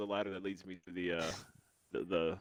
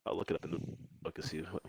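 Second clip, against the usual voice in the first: ordinary talk, nothing marked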